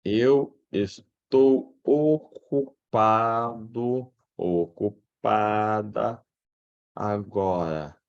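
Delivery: very drawn-out syllables, typing along; slow dictation. Acted. Student asks how to say they are busy, slow dictation. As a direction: stretch each word as you write it.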